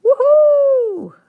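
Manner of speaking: high, drawn-out crowd cheer; high-pitched, pretend arena cheering; mock crowd screaming. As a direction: whoop like a fan at a wrestling match. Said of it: "screaming, like".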